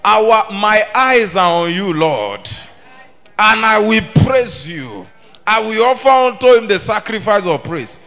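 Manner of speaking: loud, ringing declaration; preacher leading the congregation in a loud vow; full-force preaching. Acted. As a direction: proclaim it for the church to repeat.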